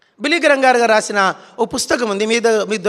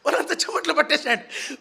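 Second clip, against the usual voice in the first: ordinary talk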